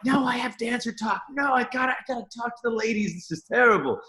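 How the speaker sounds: high, fast mock panic; panicked high voice, played for laughs. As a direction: squeal it out like you just woke in a panic. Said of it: "I woke up in a panic".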